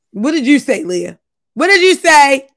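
ordinary talk

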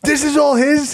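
high mock amazement; imitated amazed kid. Kid asking about Ronnie's stash, high mock amazement. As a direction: ask it wide-eyed and high. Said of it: "And he goes".

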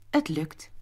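plain reading voice

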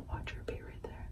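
barely audible, breath-only whisper; whispered aside. Real, not acted. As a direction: whisper it under your breath. Aside while waiting for Callie.

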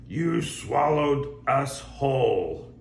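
slow, reproachful mermaid. Acted. Mermaid scolding the whale, slow and reproachful. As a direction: hold each word out.

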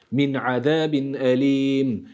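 ordinary delivery

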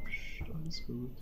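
hushed, calming whisper; whispering while hiding. Real, not acted. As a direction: hush them while hiding. Hiding from the alien.

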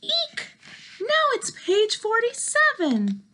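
very high eek and panic; panicked squeal. Acted. Gerald panicking at page.

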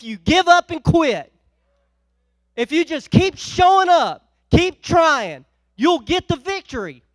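loud, rising sermon exhortation; full-force preaching. Acted. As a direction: preach it hard to the congregation.

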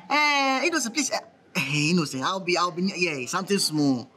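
ordinary talk, nothing marked

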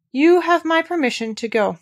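high, loud, lordly permission; imperious child ruler, pitched well up; grand dismissal. A narrator's reading. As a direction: dismiss the servants like a little Rajah. Young Rajah grandly dismissing servants.

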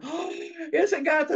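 high gasp and frightened yelp; panicked father. Acted. Father seeing the cat's long claws, panicked. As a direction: lose all bravado in a startled squeal. Boastful father sees the cat, flees.

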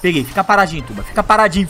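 ordinary delivery

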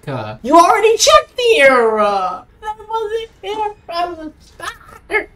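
high-pitched, whining begging; desperate pleading. Real, not acted. Player begging the pursuer to go home, desperate pleading.

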